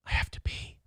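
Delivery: soft unvoiced whisper aside. Real, not acted. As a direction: whisper it like a secret. Whispered aside after announcing break.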